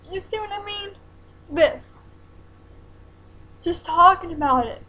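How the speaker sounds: tearful, voice breaking up; on the verge of crying; emotional. Real, not acted. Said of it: "makes me emotional".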